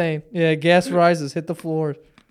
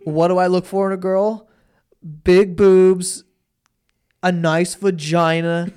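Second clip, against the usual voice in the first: mildly mocked teen Viner, louder on the punch words; lightly mimicked kid listing his tastes; Taylor Kniff; 3 semitones higher, 5 dB louder, slower than usual. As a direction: quote the boy with slow, punchy emphasis. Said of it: "he just goes".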